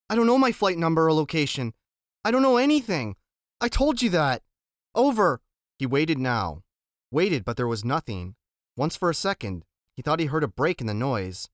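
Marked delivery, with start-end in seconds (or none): 0.1-5.4 frustrated desperation. Acted. Boy frustrated at radio.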